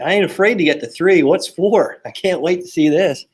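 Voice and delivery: daring his mom to reach four; defiant taunt. Retold or imitated. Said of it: "I said".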